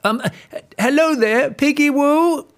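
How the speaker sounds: high, loud sing-song wolf call; sly wolf calling up to the pig, high and loud; wolf calling out sweetly. Acted. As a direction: fake friendly hail. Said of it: "shouted the wolf".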